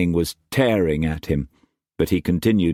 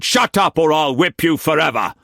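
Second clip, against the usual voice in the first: higher, louder furious shout; 10 semitones higher, 4 dB louder than usual. A narrator's reading. Struan silencing whimpering Mei Mei, angry shout. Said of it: "he shouted".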